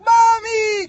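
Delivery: put-on high wailing child calling mommy; crying kid. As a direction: imitate a whiny crying toddler, high and loud. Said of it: "the kid's like".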